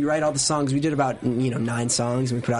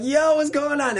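mock alarm; 14 semitones higher, 3 dB louder, slower than usual. Acts out his alarm on plane.

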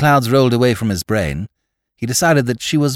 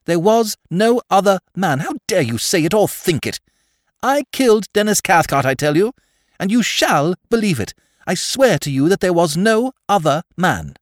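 high-pitched, vehement denial; impassioned outrage, voice lifted high; indignant insistence; 9 semitones higher, 3 dB louder than usual. A narrator's reading. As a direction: swear it fiercely, pitch raised. Girl indignantly insists on her guilt.

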